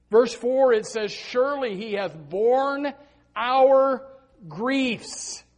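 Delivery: high, loud, drawn-out preacher's cry; impassioned pulpit shout; full-force preaching. Real, not acted. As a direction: preach it at the top of your voice, stretching key words.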